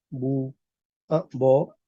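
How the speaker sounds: normal talking voice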